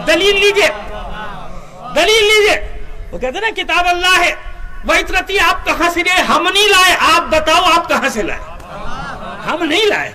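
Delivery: loud, high, driving challenge to the crowd; fiery preaching. Acted. Preacher challenges listeners to bring proof, loud and fiery. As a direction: hammer the demand for proof.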